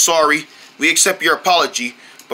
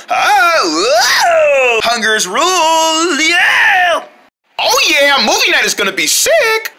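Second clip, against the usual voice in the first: excited shouting; 8 semitones higher, 3 dB louder, slower than usual. Acted. Excited commercial hype.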